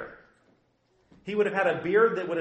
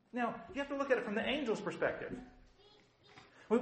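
ordinary talk, nothing marked

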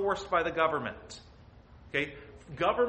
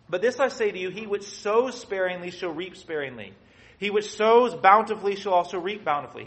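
louder, declaiming the Bible verses; scripture reading; 3 semitones higher, 8 dB louder than usual. Acted. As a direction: read the verse like it's from the pulpit. Reads scripture aloud.

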